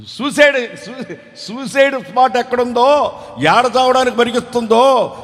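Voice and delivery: high alarmed yell of suicide; frantic husband shouting; panicked shout. Retold or imitated. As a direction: cry out in sudden panic. Husband panics, chasing crying wife.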